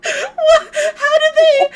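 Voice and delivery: high, loud incredulous squeal; laughing disbelief. Real, not acted. Streamer reacts to suspicious fish law, laughing in disbelief. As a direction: burst out through real laughter.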